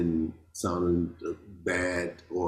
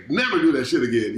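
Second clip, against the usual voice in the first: loud, raised scolding father voice; stern father; 6 semitones higher, 6 dB louder than usual. Retold or imitated. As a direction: imitate a dad laying down the law. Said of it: "your father just saying".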